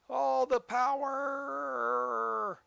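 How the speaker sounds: drawn-out high, grand "All the power"; dramatic power call. Acted. Player hammering the throttle, a slow, grand "All the power". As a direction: stretch "All the power" out slowly like a villain. Revving the train up.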